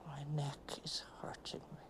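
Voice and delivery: soft unvoiced whisper; whispered complaint. Real, not acted. [name] whispering about her aching neck. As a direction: breathe it out in pain. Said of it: "repeated as broken pieces".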